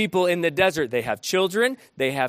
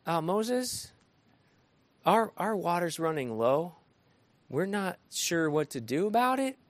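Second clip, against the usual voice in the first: softer, hesitant and mild; mock-timid polite plea; polite quiet request; 7 dB quieter than usual. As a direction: ask Moses gently, almost apologetic. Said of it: "This wasn't".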